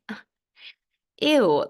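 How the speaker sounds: loud disgusted ugh, ew. Retold or imitated. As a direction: recoil in disgust. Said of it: "I'm like".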